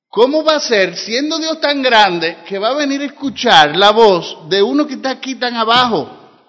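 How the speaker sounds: preacher voicing doubt at full force; preaching. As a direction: argue the puzzle out loud to the church. Preaching in mock disbelief.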